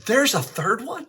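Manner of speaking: a hint of the cabbie's disbelief, drawn out; mildly astonished retold cabbie; amazed question. Cabbie learns of Protestantism.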